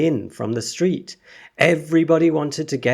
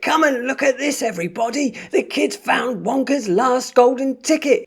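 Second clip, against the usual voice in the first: wildly excited; 14 semitones higher than usual. Acted. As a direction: announce it to the whole shop. Shopkeeper losing his head.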